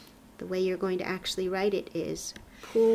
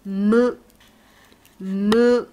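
"Mu" called out loud, much louder than the talk; loud kana model; 11 dB louder than usual. Shown as a demonstration. Teacher calling kana to class, loud and clear. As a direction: belt out "mu" for the class to copy. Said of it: "doing this last".

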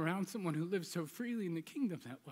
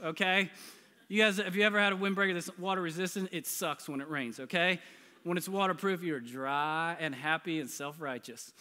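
usual speaking voice

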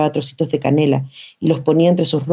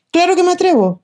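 put-on higher, defiant boy's comeback; angry retort. A narrator's reading. Angry boy talking back to his sisters. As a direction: snap back, offended. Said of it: "replica el enojado, ronco, amenazador".

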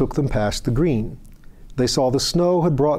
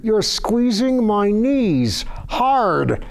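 much higher, slow, strained through clenched teeth; 10 semitones higher, much slower than usual. A narrator's reading. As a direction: squeeze the words out through locked jaw. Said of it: "Through clenched teeth".

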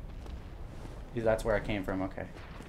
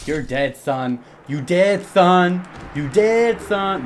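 gloating chant building louder and higher; rising victorious shout; triumphant taunt; 7 semitones higher, 9 dB louder, much slower than usual. Real, not acted. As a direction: crow over a beaten enemy, bigger each time. Gloating over a beaten enemy.

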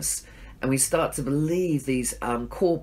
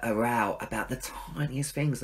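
usual speaking voice

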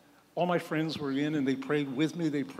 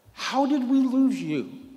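much higher, louder and slow; pleading question; 9 semitones higher, 7 dB louder, slower than usual. Retold or imitated. Priest earnestly asking the lapsed nurse, high, loud and slow. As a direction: ask it with earnest dismay.

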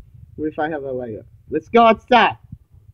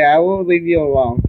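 ordinary talk, nothing marked

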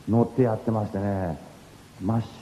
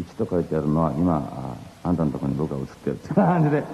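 normal talking voice

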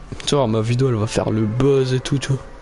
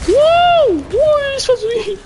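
high squealing wow; shrill surprise; 6 dB louder than usual. Real, not acted. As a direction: squeal in sudden shock. High far shout at game.

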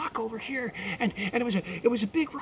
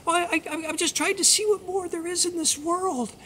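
high, hesitant stammered explanation; little mouse voice; 8 semitones higher than usual. Acted. Mouse explaining herself to the magic frog, high hesitant voice. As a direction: explain yourself in a small high voice. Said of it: "and she said".

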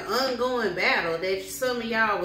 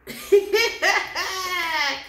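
amused laugh; 8 semitones higher, 4 dB louder than usual. Real, not acted. Laughing at own impression.

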